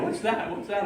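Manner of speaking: high-pitched, insistent questioning; mock-curious, pitched right up; retold eager curiosity. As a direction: imitate a girlfriend pestering to know. Girlfriend curious about clicking on phone.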